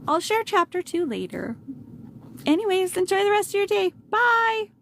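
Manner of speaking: bright, high, upbeat goodbye; cheerful sign-off. Real, not acted. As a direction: wave the listeners off happily.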